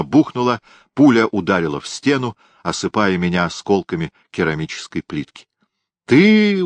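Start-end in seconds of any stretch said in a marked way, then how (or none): 6.1-6.6 high loud startled cry; shocked disbelief; surprised. A narrator's reading. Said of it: "удивился Бугай".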